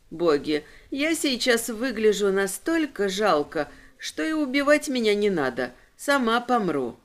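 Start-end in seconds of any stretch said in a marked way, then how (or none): none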